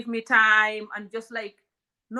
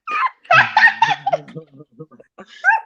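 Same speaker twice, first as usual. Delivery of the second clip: loud shrieking laugh; high shrieking laugh; 12 dB louder than usual. Real, not acted. Guest cracks herself up, shrieking with laughter. Big laugh after joke.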